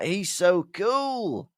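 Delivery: slow, hammed-up gushing; admiring schoolboys. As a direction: marvel like an awestruck fan. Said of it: "Fred and George are like".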